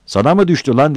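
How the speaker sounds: high, loud comeback; angry retort. Acted. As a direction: snap back at the heckler. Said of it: "Battal ona da kızdı".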